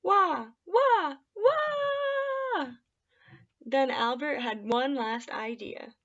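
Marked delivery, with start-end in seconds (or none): 0.0-2.7 rising wailing cry, much higher and louder. A narrator's reading. Tree wails louder at Albert, a rising crying wail. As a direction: wail it out, climbing higher each wah. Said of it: "wailed just even louder".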